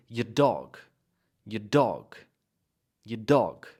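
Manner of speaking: "Your dog" run together, YOUR reduced to a weak yer; linked as one word. Shown as a demonstration. Teacher models for students, linked as one word. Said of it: "as if it's one word".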